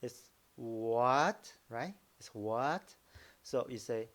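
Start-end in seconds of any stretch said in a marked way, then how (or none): 0.6-1.5 "what" drawn out, gliding upward; long rise on "what"; rising second tone. Shown as a demonstration. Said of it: "pronounce the second tone". 2.3-2.9 questioning rise on "what"; rising second tone. Shown as a demonstration. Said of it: "pronounce the second tone".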